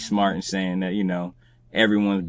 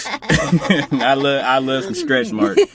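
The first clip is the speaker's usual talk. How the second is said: laughing; 6 semitones higher, 6 dB louder, much slower than usual. Real, not acted. Laughing while declaring love.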